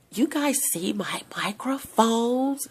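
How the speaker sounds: soft unvoiced whisper, slow. Real, not acted. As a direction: lean in and whisper the question. Whispering aside to viewers.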